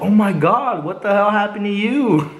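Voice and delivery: high, loud imitation of mom's shock. Mom seeing her swollen kid at the parking lot, high shocked outcry. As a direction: act out an alarmed mom, pitch jumping up.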